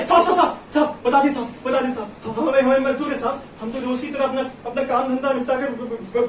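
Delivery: stammering, panicky explanation; frightened pleading. Acted. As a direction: stumble over words as you plead innocence. Threatened labourer stammers his explanation.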